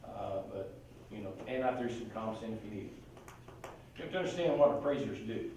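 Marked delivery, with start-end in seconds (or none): none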